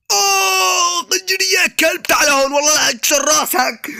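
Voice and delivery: high yelled cry of pain; sharp high cry then drawn-out shout. Acted. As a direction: yell out as if bitten. Hurt in game, yelling at dog.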